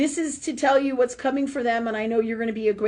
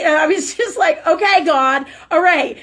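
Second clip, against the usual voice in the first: laughing exasperation; 4 semitones higher, 8 dB louder than usual. Retold or imitated. Retold exasperated prayer to God.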